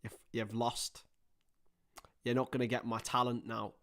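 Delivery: ordinary talk, nothing marked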